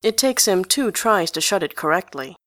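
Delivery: usual speaking voice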